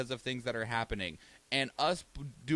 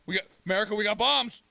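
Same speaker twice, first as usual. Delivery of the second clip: higher, louder bragging taunt; mock taunt; 7 semitones higher, 6 dB louder, faster than usual. North Korea taunting America about bombs, higher loud brag. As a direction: wave the bombs in their face.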